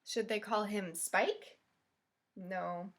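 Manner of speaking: ordinary talk, nothing marked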